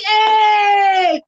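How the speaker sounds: high excited cheer of the crowd; cheering shout. Retold or imitated. The platoon cheering as he makes weight, shouting. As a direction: yell it like the whole unit cheering.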